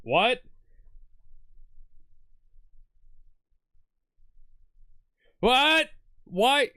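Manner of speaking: baffled yelps at the screen; shocked disbelief. Real, not acted. Reacting to show opening.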